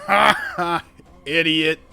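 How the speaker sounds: loud, jeering ha ha and insult; mocking creature laugh. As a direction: jeer at a stabbed comrade, loudly. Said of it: "the other hobgoblin just stands back and is like".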